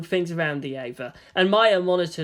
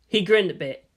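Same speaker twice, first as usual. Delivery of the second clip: ordinary delivery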